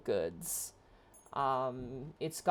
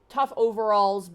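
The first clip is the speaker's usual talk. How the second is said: ordinary talk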